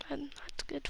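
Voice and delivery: barely audible whisper to himself; whispered aside. Real, not acted. Thinking up a name for the bank caller, whispered aside. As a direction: mutter it under your breath.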